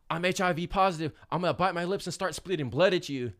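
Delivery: loud, high-pitched mock threat; reenacted loud threat; retold threat. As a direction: raise voice and pitch, warding them off. Threatening officers to avoid blood draw.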